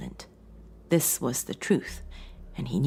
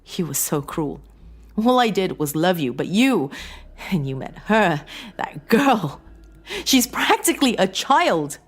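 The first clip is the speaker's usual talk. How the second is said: tearful; 6 semitones higher, 4 dB louder than usual. A narrator's reading. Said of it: "Her tears began to flow".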